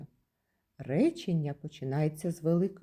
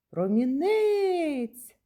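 "Промінець" as a long, high call; 7 semitones higher, 4 dB louder, much slower than usual. Shown as a demonstration. Teacher calls the word to find its stress, calling "Промінець" out. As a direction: call "Промінець" out high, stretching the last syllable. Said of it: "покличемо".

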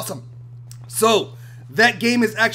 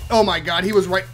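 normal talking voice

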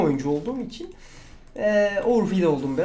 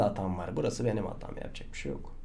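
resigned mutter; 11 semitones lower, 8 dB quieter than usual. Real, not acted. Resigned after dying.

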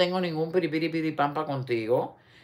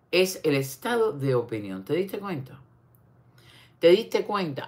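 ordinary delivery

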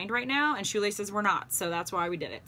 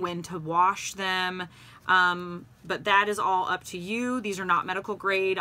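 usual speaking voice

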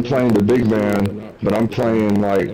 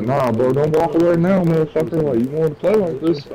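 higher, heated callout at a teammate; mock angry callout; 7 semitones higher, faster than usual. Telling teammate not to walk away, heated and higher. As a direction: call him out, pitched up and riled.

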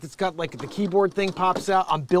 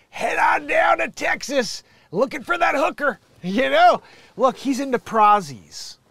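mock Southern accent; 10 semitones higher, 9 dB louder than usual. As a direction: belt it out like a brash Texan cowboy. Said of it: "put on his best Southern accent".

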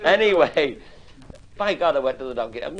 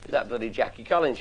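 usual speaking voice

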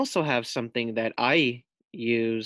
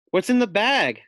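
growl; 8 semitones higher, 6 dB louder than usual. Acted. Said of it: "she growls".

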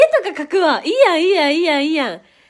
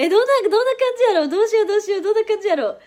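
fast, higher, louder excited babble; excited chatter; 7 semitones higher, 5 dB louder, much faster than usual. Real, not acted. Streamer thrilled to play weather lady, excited. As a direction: rattle it off, thrilled at the idea.

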